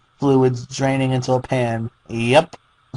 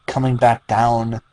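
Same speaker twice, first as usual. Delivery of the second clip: usual speaking voice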